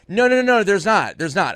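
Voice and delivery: high, loud protest, easing down; retold frantic denial. Joker stopping friend's alarm backstage, acted frantic denial. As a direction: rush to call off the false alarm. Retelling own hurried denial.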